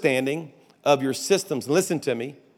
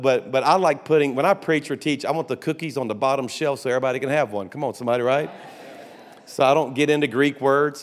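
ordinary talk

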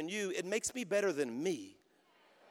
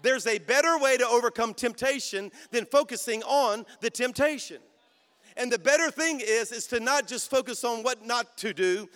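full-force preaching; 3 semitones higher, 5 dB louder than usual. Acted. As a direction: drive the point home to the church.

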